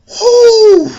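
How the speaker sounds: loud high laughing whoop; excited whoop. Real, not acted. Fan cheering for the Madara fight, loud laughing whoop. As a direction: let out a hyped yell. Hyped about the chapter.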